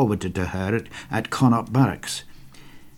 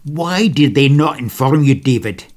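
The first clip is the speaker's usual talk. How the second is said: louder, higher indignant question; angry; 4 semitones higher, 7 dB louder than usual. Acted. He asks David why he wasn't informed, angry. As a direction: challenge him, the second WHY driven hard. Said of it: "he sounded angry when he asked".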